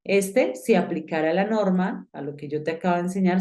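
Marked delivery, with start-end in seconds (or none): none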